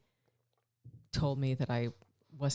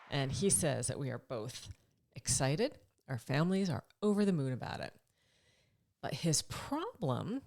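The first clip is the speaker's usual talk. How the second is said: usual speaking voice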